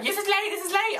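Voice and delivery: high-pitched mock yell of complaint; mock shouting. Rude customer shouting at the paper boy, high mock yell. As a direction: imitate an irate customer, pitch jumping way up. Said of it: "they shout at you like".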